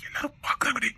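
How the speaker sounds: hushed whisper so others won't hear. Acted. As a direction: whisper it so the people outside can't hear. Husband hushes wife, people watching.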